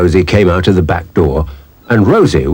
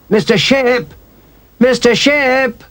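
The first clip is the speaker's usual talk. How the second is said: put-on high, drawn-out child's call across the yard; a child calling a neighbour from outside, long slow call; slower than usual. A narrator's reading. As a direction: stretch the name out slowly to reach him.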